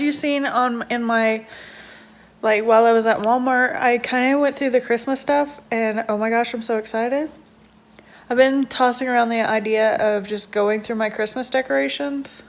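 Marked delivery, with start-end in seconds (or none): none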